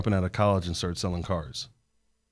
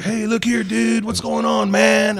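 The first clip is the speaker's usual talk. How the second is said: much higher, louder laid-back hippie voice; Randalls impression; 12 semitones higher, 6 dB louder, slower than usual. Imitating his hippie mentor's greeting to the host, high and loud. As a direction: greet like an easygoing old hippie, pitched way up. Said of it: "he talked just like that".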